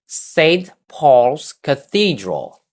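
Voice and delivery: "Saint Paul's Cathedral" slow, stress on "Saint", falling at the end; slow teacher model phrase; model for repeating. Shown as a demonstration. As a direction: say it slowly for the class to echo. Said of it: "Read after me".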